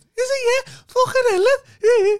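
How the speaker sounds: very high, loud excited squeals; excited high pitch. Shown as a demonstration. Brummie showing how they sound when excited, high-pitched squeal. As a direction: shoot right up high and loud. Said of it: "I go high pitch all the time".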